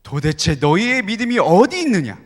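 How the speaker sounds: Jesus rebuking the frightened disciples; stern rebuke. Acted. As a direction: scold them for lost faith. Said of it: "누가복음엔 어떻게 말씀하실까요".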